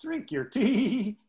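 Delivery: slow, high drawn-out drink your tea; mock towhee call; comical towhee song. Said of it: "the most comical song".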